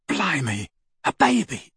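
hushed start, then high astonishment; amazed. A narrator's reading. Ron reacting to news of a baby, amazed. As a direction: marvel as if it's unheard of. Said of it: "as if he had never heard of such a thing".

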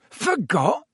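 high, weak echo of shock; faintly aghast question; weak disbelief. A narrator's reading. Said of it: "said Stan weakly".

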